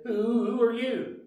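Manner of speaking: high, loud and slow, stammering in fear; man asking the unseen voice, trembling; shaky voice. A narrator's reading. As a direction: stutter it out, frightened. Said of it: "In a shaky voice".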